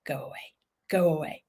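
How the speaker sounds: hushed, unvoiced go away; whispered dog voice. Teacher voicing a frightened dog, whispering. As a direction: whisper it like a scared dog warning you off. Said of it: "that dog's now going".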